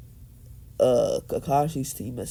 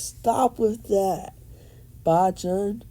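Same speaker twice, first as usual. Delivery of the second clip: higher, drawn-out mock protest; embarrassed protest; 6 semitones higher, slower than usual. Naruto to his hugging grandmother, higher mock protest. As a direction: whine it at an over-affectionate grandma.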